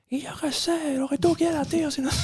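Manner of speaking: mucho más agudo, imitando al chaval aburrido; voz de adolescente que no quiere nada; hijo pasota. Retold or imitated. (imitando al hijo, agudo y sin ganas)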